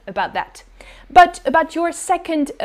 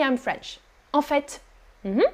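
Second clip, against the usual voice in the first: ordinary delivery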